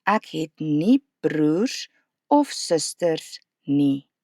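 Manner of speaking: slow, careful Afrikaans negative sentence; teacher modelling slowly; slow model sentence. Shown as a demonstration. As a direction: spell out the pattern slowly. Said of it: "just borrow the whole pattern".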